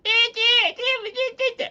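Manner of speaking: very high, loud mock bawling; wicked witch jeering with fake sobs; witch's mocking cry. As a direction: squeal out mocking "eh-eh" cries. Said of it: "making fun of Dorothy when she's crying".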